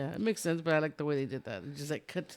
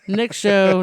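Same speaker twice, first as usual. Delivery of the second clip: loud; 4 semitones higher, 13 dB louder than usual. Real, not acted.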